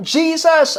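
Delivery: high-pitched call, imitating a lone shouter; retold shout. Someone in the crowd shouts for Jesus, retold shout. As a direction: cry it out as one voice in the crowd. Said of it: "maring may sumigaw doon ng".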